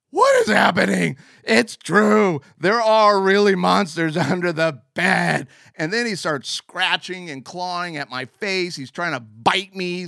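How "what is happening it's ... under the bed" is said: loud, higher terrified yelling; panicked scream. Retold or imitated. Terrified boy screaming at a monster under the bed. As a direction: shriek in total panic.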